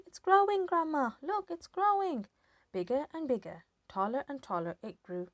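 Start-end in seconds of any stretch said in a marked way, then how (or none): 0.1-2.3 excited yell. A narrator's reading. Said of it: "he yelled".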